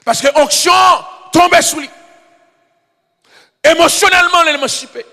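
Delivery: shouted, high-pitched sermon climax; fiery preacher shouting to the church; preaching at full force. Acted. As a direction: proclaim it with all your power.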